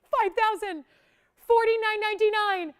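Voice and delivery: excited sales pitch; excited price reveal. Real, not acted. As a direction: ring out the new price like big news. Host reveals a price drop.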